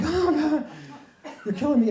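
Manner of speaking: high-pitched exasperated groan; hammed-up pleading despair; mock dismay. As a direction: act pained by each new revelation. Acted dismay at more charges.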